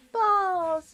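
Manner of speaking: loud, high exclamation; high loud cry. Real, not acted. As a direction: shout it out in dismay. Reacting to game loss.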